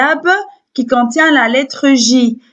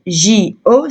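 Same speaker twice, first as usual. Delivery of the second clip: normal talking voice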